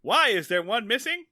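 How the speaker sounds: put-on high-pitched kid delivering a punchline; Opie. Host playing a boy finishing his joke, high-voiced. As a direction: land the joke like a pleased child. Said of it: "So Opie comes in".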